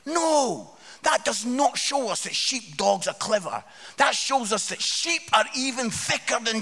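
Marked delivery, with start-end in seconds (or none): none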